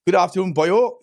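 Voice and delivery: bad Welsh accent that drifts toward Bristol; hammed-up Welsh greeting to a viewer; terrible Welsh accent. Greeting a viewer in a bad Welsh accent. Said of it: "That's a terrible accent".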